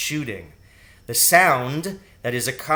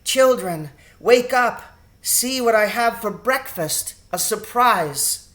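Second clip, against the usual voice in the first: bright, higher and louder calling out; a mother calling her children to a treat; cheerful call; 8 semitones higher, 4 dB louder than usual. A narrator's reading. Mother wakes her children with a breakfast surprise, cheerful call. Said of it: "calling cheerfully as she went".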